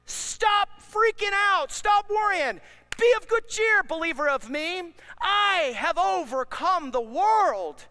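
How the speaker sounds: loud high proclamation, slowing at the end; preaching at full force. Acted. Preacher quoting Jesus to believers, loud and high. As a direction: proclaim it like a rallying cry. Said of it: "Jesus said".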